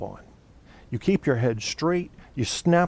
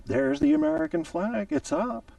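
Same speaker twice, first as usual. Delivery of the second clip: mocking a defender; 12 semitones higher than usual. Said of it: "How would you feel".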